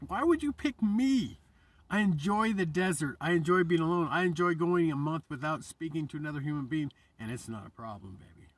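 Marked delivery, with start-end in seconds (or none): 0.1-1.4 asking God. Retold or imitated. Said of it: "God, I'm like". 1.9-6.9 higher and louder, heated and insistent; fired-up outburst. Real, not acted. Vlogger telling God why pick me, louder and higher. Passionate outburst about loving solitude.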